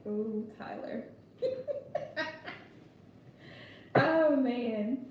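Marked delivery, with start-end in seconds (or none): 0.1-1.0 amused tease. Retold or imitated. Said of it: "I'm like". 1.4-2.5 bright giggle up high; high laughter. Real, not acted. Host amused by Tyler's trolling, high laughter.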